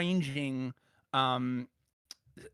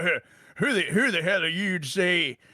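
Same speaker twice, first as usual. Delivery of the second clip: put-on a light American accent, a bit louder, as an indignant reader; mock an indignant voter in an American accent; 3 semitones higher, 4 dB louder than usual. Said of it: "I don't know why I'm doing an American accent".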